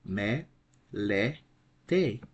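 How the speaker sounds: "Me, le, te" in three separate pieces; syllable by syllable. Shown as a demonstration. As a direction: break "Me, le, te" apart with short pauses. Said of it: "We have three syllables".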